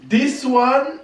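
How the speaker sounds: mother shows daughter pants, rising laughing squeal; excited squeal. Real, not acted. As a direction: build to a laughing shriek.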